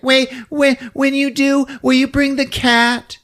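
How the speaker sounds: high-pitched imitation of a woman; falsetto-like pleading woman; woman's voice. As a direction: ask in a high put-on female voice. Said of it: "And she's like".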